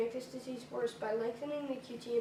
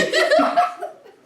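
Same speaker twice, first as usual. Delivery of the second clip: squealing laugh; cracking up loudly; high burst of laughter; 19 dB louder than usual. Real, not acted.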